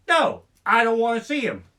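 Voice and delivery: emphatic refusal. Real, not acted. Punchline refusal.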